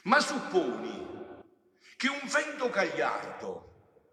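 loud, high-pitched proclamation; impassioned preaching. Real, not acted. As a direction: preach it at full force, stretching key phrases. Preaches at full force.

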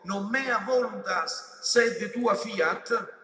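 very slow, loud, high intoned prayer, stretching each word; solemn intoned Latin. Acted. Preacher intoning Jesus' Latin prayer, slow solemn chant.

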